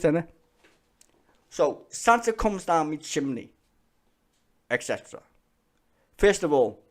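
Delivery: usual speaking voice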